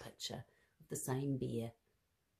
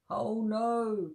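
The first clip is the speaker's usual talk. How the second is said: put-on Old Bear's louder, higher cry of alarm; 7 semitones higher, 10 dB louder than usual. Old Bear alarmed as the basket rolls downhill, crying out. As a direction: cry out as the basket starts to roll. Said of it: "cried Old Bear".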